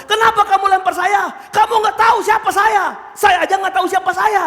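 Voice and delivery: high shouted tirade; acted rage. Engineer pulls rank on his worker, shouting angrily. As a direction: berate someone as the outraged boss. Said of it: "lihat di marah-marahnya".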